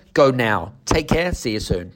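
usual speaking voice

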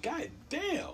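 high, soft mock curse; imitated nurse's cursing. Nurse cursing at a patient's long wait, high soft exclamation. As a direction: squeal it lightly as the nurse. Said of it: "Like that".

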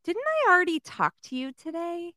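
high, incredulous receptionist voice; mock snippy receptionist. Receptionist recognizing a repeat caller, mimicked high and incredulous. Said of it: "she goes".

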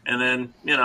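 normal talking voice

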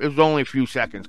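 ordinary delivery, nothing marked

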